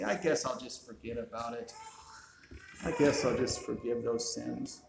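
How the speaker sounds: low, soft, casual musing; imitating a shrugged-off decision, quiet and low; mock offhand musing. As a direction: toss it off quietly, as an imagined idle thought. Mock casual quote of God deciding.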